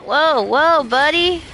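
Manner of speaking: high, loud startled shout; startled yell. Real, not acted. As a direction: jump back in surprise. Startled by enemies.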